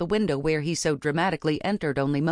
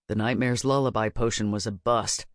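put-on grim young witch Baz; 6 semitones lower than usual. A narrator's reading. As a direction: lower character voice, explaining.

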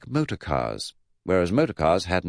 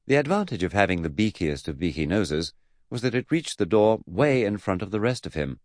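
usual speaking voice

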